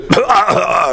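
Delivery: sudden hacking cough, choking. Real, not acted. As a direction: cough hard as if something went down wrong. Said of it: "Excuse-moi, je m'étouffe".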